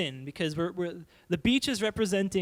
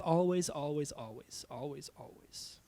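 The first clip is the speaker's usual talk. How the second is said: always repeated, dropping softer to a whisper; fading repetition; 4 semitones lower, 5 dB quieter, slower than usual. Shown as a demonstration. Preacher fading always to a whisper on sin. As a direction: let each always fade away. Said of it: "repetition fading".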